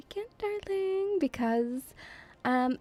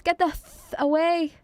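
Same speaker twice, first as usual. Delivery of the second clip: mock scolding fan; 5 semitones higher than usual. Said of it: "I'll be like".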